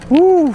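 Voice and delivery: high, loud "woo"; startled, thrilled storm whoop; excited whoop. Real, not acted. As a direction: let out a whoop at the lightning. Reacting to lightning during storm.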